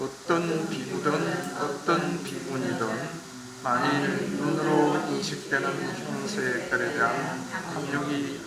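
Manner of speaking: slow, measured scripture recitation; sutra reading. Acted. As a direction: read the sutra slowly and solemnly. Reads the sutra passage aloud. (천천히 경전 낭독)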